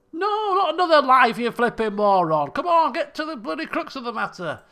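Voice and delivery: high, loud, mock-angry outburst; exasperated retort. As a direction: impatiently tell off a time-waster. Exasperated retort to disguised character.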